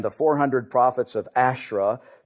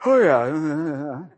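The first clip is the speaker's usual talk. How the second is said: blasé shrug. Retold or imitated. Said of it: "we kind of".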